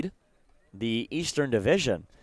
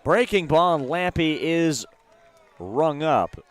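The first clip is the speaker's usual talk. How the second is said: louder, raised play-by-play punch; excited strikeout call; 3 semitones higher, 5 dB louder, slower than usual. Real, not acted. Commentator calling a batter rung up on a breaking ball, excited. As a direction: punch out the big call, drawing out the middle.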